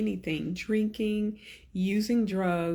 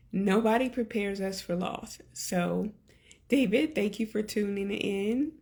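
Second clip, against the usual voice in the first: ordinary delivery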